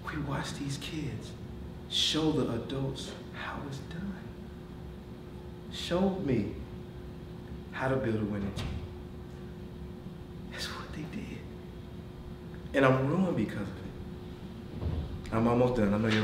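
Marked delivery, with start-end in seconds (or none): 0.1-4.1 hushed. Real, not acted. Awed by the kids' example. 10.5-11.4 breathy whisper, almost to himself; whispered aside. Real, not acted. Coach tells the team what the kids did, in a whisper. Awed remark about the kids.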